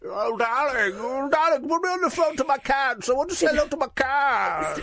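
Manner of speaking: high, camp [name]. Retold or imitated. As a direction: fuss over the cats, darling. Freddie impression continues.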